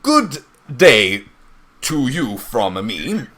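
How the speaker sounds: put-on loud, slow heavy foreign accent; hammed-up foreign accent, stilted grammar; heavy accent, broken Northern Common. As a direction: speak the words haltingly in a thick foreign accent. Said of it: "to Northern Common with a heavy accent".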